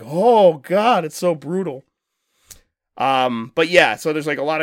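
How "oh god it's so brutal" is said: loud, high, drawn-out "oh God"; mock-horrified exclamation, pitched high; appalled reaction. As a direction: recoil aloud at something brutal, playfully horrified.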